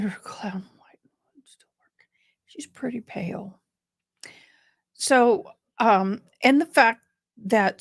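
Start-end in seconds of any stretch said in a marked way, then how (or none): 0.0-3.6 a catty whisper; whispered aside. Real, not acted. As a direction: lean in and whisper the dig. Catty aside about her pallor.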